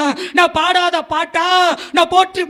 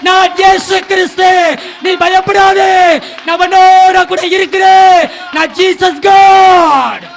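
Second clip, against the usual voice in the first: booming divine voice, much louder; voice of Jesus, loud; 3 semitones higher, 10 dB louder than usual. Retold or imitated. Preacher voicing Jesus calling to him in the dark room, booming. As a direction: call it out like a voice from heaven. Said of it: "சத்தமா கேக்குது".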